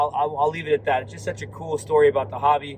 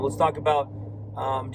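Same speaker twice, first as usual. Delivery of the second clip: ordinary talk, nothing marked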